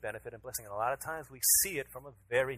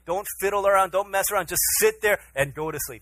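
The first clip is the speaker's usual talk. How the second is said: raised, louder voice, firm bedtime order; stern dad warning; 8 semitones higher, 7 dB louder than usual. Retold or imitated. Father telling his son to stay in bed, stern. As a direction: spell out the rule for a small child.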